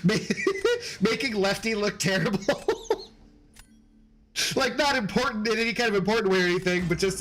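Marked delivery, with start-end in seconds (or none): 0.2-0.9 high-pitched burst of giggling; high giggle. Real, not acted. Streamer giggling at his friend's slip, high giggle. Cracking up at Lefty. 2.4-3.0 high-pitched burst of giggling; high giggle. Real, not acted. Streamer giggling at his friend's slip, high giggle. Cracking up at Lefty.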